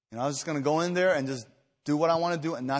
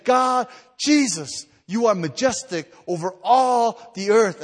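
slow, high, loud declaration of praise; proclaiming prayer; 7 semitones higher, 8 dB louder, slower than usual. Shown as a demonstration. Preacher shows the church how to praise, loud and slow. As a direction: cry it out to God, drawn out. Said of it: "we need to spend time saying".

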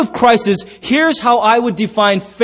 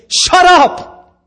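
high, drawn-out yell; acted loud, exasperated outburst; shouted rebuke; 9 semitones higher, 5 dB louder than usual. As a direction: shout the room into silence. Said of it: "then he rebukes them".

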